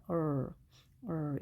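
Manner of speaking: 얼 as English "er", tongue lightly curled back. Shown as a demonstration. Teacher showing learners the R, 얼 with a curled tongue. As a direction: hold 얼 low, curling the tongue without touching the palate. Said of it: "혀만 살짝 구부려주면".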